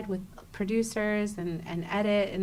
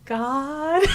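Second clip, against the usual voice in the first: laughing; 7 semitones higher, 7 dB louder than usual. Real, not acted. Friend's reaction to disembodied voice.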